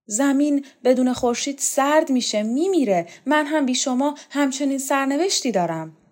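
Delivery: high, imploring declaration; pleading. A narrator's reading. Borzu tells Showkat he will die without her, pleading. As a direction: beg as if life depends on it. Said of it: "برزو به شوکت التماس کرد".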